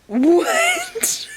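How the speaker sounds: high, far-off mock what; replaying her stunned what; retold shocked what. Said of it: "read that and went".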